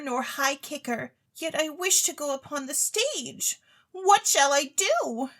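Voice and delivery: put-on high, eager young lady pleading; a rich, silly girl begging to go on stage; Claribel's voice. Claribel begs the chemist for stage talent, high and eager.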